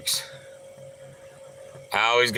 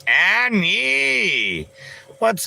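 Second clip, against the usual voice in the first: excited call; 10 semitones higher, 3 dB louder, much slower than usual. Real, not acted. Calling out to a viewer.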